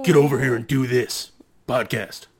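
low, loud creepy announcer; creepy man voice. Pretend. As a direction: growl it like a creepy wrestling host. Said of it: "your creepy man voice".

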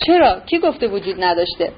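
ordinary delivery

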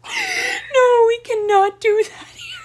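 high, loud laughing protest. Real, not acted. Laughing at friend, refusing to discuss the edit.

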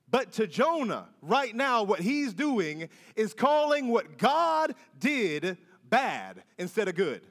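normal talking voice

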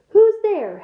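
loud, high call into the dark. A narrator's reading. Hagrid calling into the dark clearing, loud challenge. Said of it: "Hagrid called".

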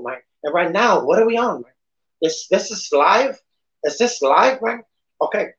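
lightly done Tony Montana tough guy; a hammed-up Scarface impression. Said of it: "that would be my fucking".

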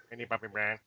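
low, soft mumbled name; teasing impression of a besotted friend; mock friend's answer. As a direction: drop low and quiet for the punchline.

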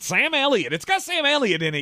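high-pitched indignant appeal; incredulous protest. Real, not acted. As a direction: argue as if the name settles everything, pitch soaring. Co-host protests show's dismissal.

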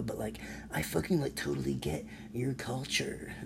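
whispered mock apology. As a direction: whisper it like a sheepish know-it-all.

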